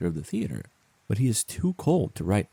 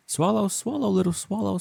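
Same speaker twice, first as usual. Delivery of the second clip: much higher, pleading call; 13 semitones higher, 4 dB louder than usual. Acted. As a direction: reach up high, begging him to stay. Prince begs swallow to stay.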